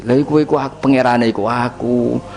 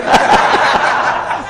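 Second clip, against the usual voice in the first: breathy laugh. Real, not acted. Laughs after teacher joke. (ngguyu lirih)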